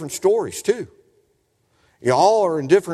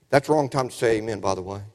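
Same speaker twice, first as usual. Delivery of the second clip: ordinary talk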